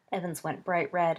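ordinary delivery

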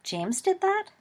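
high-pitched, rising surprised question. Acted. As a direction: ask it in clear astonishment. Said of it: "Remus looked surprised".

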